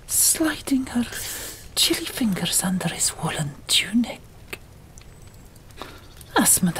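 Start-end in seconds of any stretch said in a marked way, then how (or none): none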